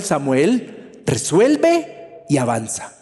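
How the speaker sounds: God calmly telling Samuel to move on; gentle command. Retold or imitated. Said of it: "Dios le dice a Samuel".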